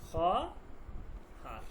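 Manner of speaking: খহার drawn out very slowly, no "o" sound after খ; slow model pronunciation of খহার for students; careful pronunciation. Shown as a demonstration. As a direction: stretch খহার out syllable by syllable, no "o" sound. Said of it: "ওয়ের উচ্চারণ হবে না".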